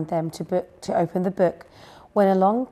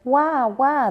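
high, loud chick cries; crying out; 7 semitones higher, 5 dB louder than usual. A narrator's reading. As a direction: wail out in a storybook way. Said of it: "they cried".

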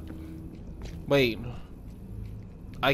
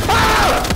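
short high loud shriek; startled scream; 8 dB louder than usual. Real, not acted. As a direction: scream at a sudden scare. Startled while playing.

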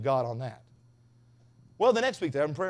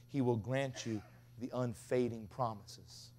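slow, weighty promise; 3 semitones lower, 7 dB quieter, much slower than usual. Acted. Slow emphatic sermon line.

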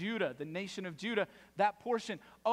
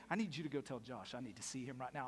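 low, soft, quick order; stern dad; 7 semitones lower, 9 dB quieter, faster than usual. Retold or imitated. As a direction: imitate a dad quietly laying down the law. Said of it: "as soon as my dad would say to me".

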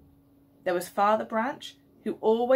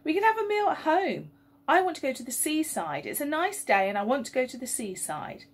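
put-on high, insistent girl's voice; a spoilt daughter nagging her family, high and whiny; 6 semitones higher than usual. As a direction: demand the seaside like a spoilt child. Melanie demanding the seaside.

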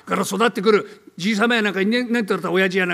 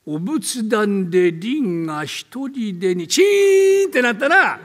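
slow, deliberate telling; much slower than usual. Acted. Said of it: "ほら、ゆっくりやれよ".

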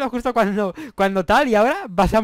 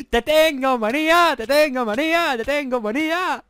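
slow, higher teasing singsong; singsong taunt; 6 semitones higher, 4 dB louder, slower than usual. Acted. Gamer teasing the player he keeps hitting, slow higher singsong. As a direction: draw it out like a playground tease.

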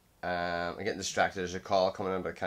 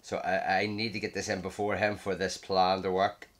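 normal talking voice

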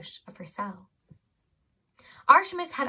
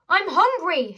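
louder, higher "I'm hungry"; shouted; 8 semitones higher, 7 dB louder, slower than usual. Acted. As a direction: yell it at the guard. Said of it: "shouted Holly".

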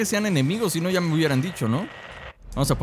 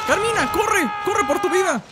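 high-pitched frantic cry to run; playfully panicked, very high; panicked urging. Real, not acted. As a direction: call out as if danger is closing in. Spotted, urging companion to flee.

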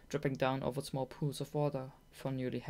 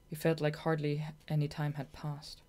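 plain reading voice

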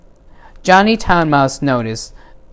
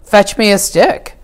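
rising to a loud panicked cry; cook's scream; 6 semitones higher, 4 dB louder than usual. Acted. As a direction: shout for the cat in alarm. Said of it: "The cook screamed".

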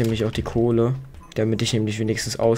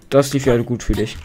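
ordinary talk

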